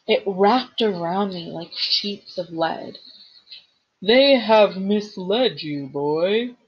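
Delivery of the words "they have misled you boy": a hint of a slow, heavy monster; lightly voiced cold ancient thing; ancient pit voice. A narrator's reading. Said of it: "it said".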